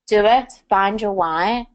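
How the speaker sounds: ordinary delivery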